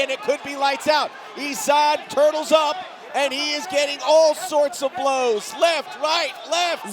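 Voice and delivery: high, loud knockout call; excited shout. Real, not acted. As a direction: yell the finish as it happens. Knockout finish called live.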